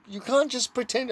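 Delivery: usual speaking voice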